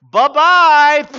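put-on a little girl waving off the bug gleefully; mock childish goodbye; cheeky child. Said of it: "comes up to the beetle and goes".

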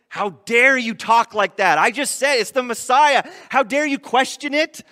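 a pretend angry rebuke, not meant; mock indignation. Philip pretending to snap at doubting Nathanael, mock indignant. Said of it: "Philip doesn't get mad".

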